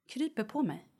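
ordinary talk

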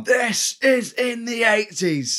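high, slow, over-explained mock voice; mock film announcement. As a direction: spell out the obvious, hammed-up and slow. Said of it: "where they've gone".

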